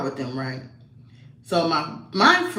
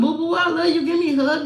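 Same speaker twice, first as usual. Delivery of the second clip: higher, affectionate dad voice; a father cooing back at his daughter, lightly voiced; doting dad; 11 semitones higher, faster than usual.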